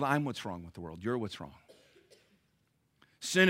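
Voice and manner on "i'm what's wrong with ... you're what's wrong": quiet confession. Real, not acted. Quiet confession after preaching.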